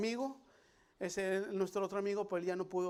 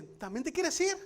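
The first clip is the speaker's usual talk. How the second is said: raised, higher challenging question; high questioning Jesus; 8 semitones higher, 4 dB louder than usual. Retold or imitated. Jesus asking Peter if he will leave too, raised voice.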